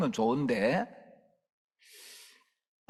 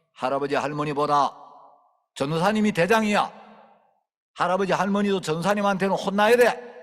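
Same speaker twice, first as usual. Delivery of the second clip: loud, higher, lay down the rule; commanding declaration; 5 semitones higher, 5 dB louder than usual. Retold or imitated. Preacher telling a child he outranks grandparents, loud and firm. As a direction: announce who is boss.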